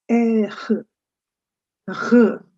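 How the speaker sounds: "R" as "air" ending in throat friction; guttural French R. Shown as a demonstration. Teacher showing the texture, guttural French R. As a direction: rasp "R" at the back of the throat. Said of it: "look at the texture of the R sound".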